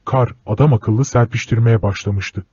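usual speaking voice

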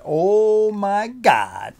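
high, slow, drawn-out oh my God; stretched-out awestruck cry; amazed exclamation. Real, not acted. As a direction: let it out in sheer awe. Unboxing reveal of the boots.

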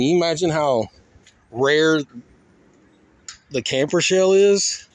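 normal talking voice

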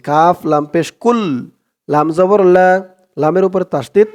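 ordinary talk

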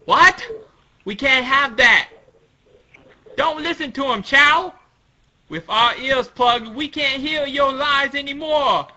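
loud, high, defiant character voice; indignant Tontada. Acted. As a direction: shout down the enemy's lies. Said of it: "And the Tontada's like".

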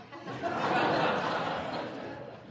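high breathy burst of laughter at the memory; loud laugh. Real, not acted. Punchline of ladder story.

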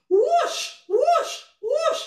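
high swooping whooshes; wind whooshing. Acted. Storyteller imitating the wind, high rising whooshes.

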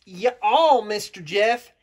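drawn-out put-on Southern accent. Southern character correcting Mister Jeff, in a Southern accent. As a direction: play a Southern character greeting everyone. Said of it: "You gotta get my accent better".

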